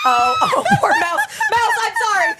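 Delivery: high, loud laughing apology. Real, not acted. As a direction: burst out laughing while apologizing. Friend hurt by loud noise.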